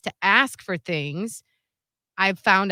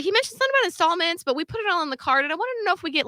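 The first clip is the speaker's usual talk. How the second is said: high-pitched imitated caller; smooth phone manner; 9 semitones higher, much faster than usual. Person negotiating installments with company, phone manner. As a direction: keep it high, talking your way in. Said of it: "he's so good on the phone".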